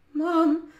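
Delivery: high, nervous opening; wobbly. A narrator's reading. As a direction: begin timidly in a high voice. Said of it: "Her voice sounded as wobbly as her knees felt".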